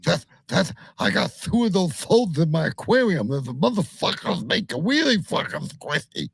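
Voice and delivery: high, spluttering cartoon lisp; Daffy Duck. Co-host bragging about toads in his aquarium, as Daffy Duck. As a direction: put on a lisping cartoon duck. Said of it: "did you just sound like Daffy Duck?".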